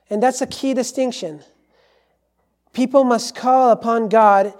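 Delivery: forceful preaching. Real, not acted. Preacher to congregation on the one way to God, forceful preaching. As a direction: drive it home to the congregation.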